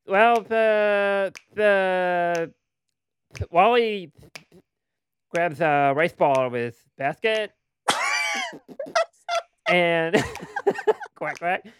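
high spitting cartoon duck; Daffy Duck voice. Shown as a demonstration. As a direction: narrate it as a sputtering duck. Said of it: "Daffy Duck the shit out of this".

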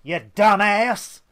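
loud, drawn-out mock insult; high, slow shouted jeer; retold angry yell. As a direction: imitate an irate pedestrian yelling at a driver. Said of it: "she just went".

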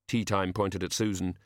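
plain reading voice

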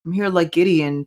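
ordinary talk

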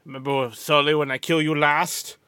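deep, deadpan movie villain line; low action hero quote. Retold or imitated. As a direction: quote it like the tough guy in the film. Quoting a famous movie line.

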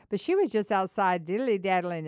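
ordinary talk

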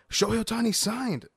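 high-pitched announcement of huge news; excited coworker. Retold or imitated. Coworker telling the speaker about a shock signing, excited. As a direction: break the news in a high, thrilled voice. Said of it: "my coworker comes up and he's like".

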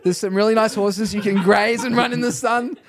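loud, high, amused salesman-like persuasion; retold coaxing negotiation. Minister negotiating a demon out, retold coaxing. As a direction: coax it along, building to an urgent push. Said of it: "I'm negotiating with it".

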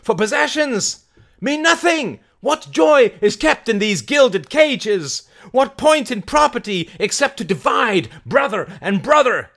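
high, loud rallying speech; Mary, fiery orator. Acted. Mary preaching revolt to the crowd, high loud oration. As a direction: declaim it to a crowd at full voice.

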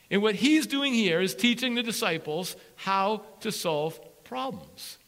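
usual speaking voice

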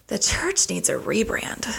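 slow, half-whispered aside to self; muttering to oneself. Retold or imitated. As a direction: retell a private thought under your breath. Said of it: "to myself".

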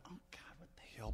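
whispered, dismayed aside to himself; retold thought in a quick whisper; hushed inner thought. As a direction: mutter it under your breath, almost no voice. Retells his private dismayed thought.

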